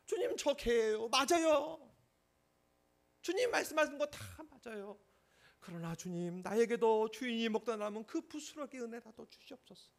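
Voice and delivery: high, loud humble begging; desperate plea. Acted. Woman admitting she is a dog and begging Jesus, loud desperate plea. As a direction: cry out humbly for mercy.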